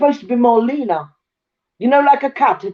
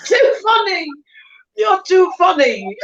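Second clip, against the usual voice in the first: high, slow amused remark; 7 semitones higher, slower than usual. Real, not acted. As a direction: say it still laughing. Amused reaction.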